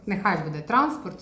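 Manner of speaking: usual speaking voice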